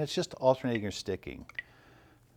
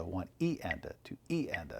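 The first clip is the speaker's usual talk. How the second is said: softer count, "E" standing out, "and a" falling; accent on second sixteenth; 6 semitones lower, 7 dB quieter than usual. Shown as a demonstration. Drum teacher correcting himself on second sixteenth, "E" stressed. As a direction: stress "E" in "one E and a, two E and a". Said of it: "so the second sixteenth note".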